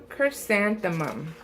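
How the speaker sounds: ordinary delivery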